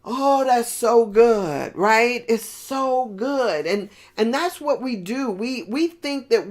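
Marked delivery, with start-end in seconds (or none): 0.0-1.7 moved delight. Retold or imitated. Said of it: "it was just like".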